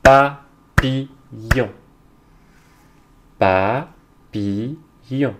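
Papillon very slowly in three beats, pa, pi, llon; syllable by syllable. Shown as a demonstration. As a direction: beat out each syllable of Papillon. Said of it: "on va frapper les syllabes".

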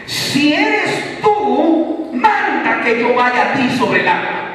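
high, loud fervent preaching; full-force preaching. Acted. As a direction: proclaim it to the whole church. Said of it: "óigalo bien, pastor".

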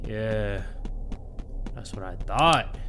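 low-pitched cover for nerves; put-on confidence, deep in the voice; nervous false confidence. Real, not acted. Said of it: "I'm nervous as fuck. That's the only reason why I'm talking like this".

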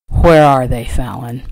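loud, demanding man's voice. A narrator's reading. Husband waking Fallon to find his boots, demanding. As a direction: demand an answer, hard on the first words. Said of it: "His demanding voice woke her instantly".

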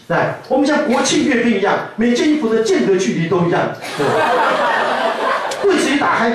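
high, hammed-up drill-sergeant pride; mock proud soldier voice, pitched high; fussy military husband. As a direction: boast about folded laundry like a soldier. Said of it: "husband's military tidiness speech".

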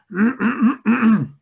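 loud, forced throat clearing; throat-clearing demo. Shown as a demonstration. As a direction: clear your throat to show the tic. (carraspeo forzado de demostración)

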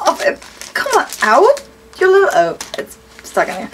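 ordinary talk, nothing marked

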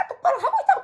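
quick mock replay of yelling back; retold bickering retort. Daughter retorts to dad in replayed quarrel, mock yell. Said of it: "이게 된 거예요".

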